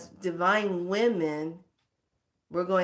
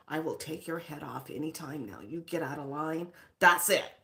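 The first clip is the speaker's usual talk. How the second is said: warrior; 4 semitones lower than usual. Acted. Warrior side warning anyone out of line, lightly voiced menace. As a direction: lower and soften into a warrior's warning. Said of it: "the warrior is like".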